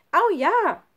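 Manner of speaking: high, loud eager cheer; excited girl. Acted. Nele accepting Schlunz's slingshot offer, excited and loud. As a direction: jump at the offer gleefully.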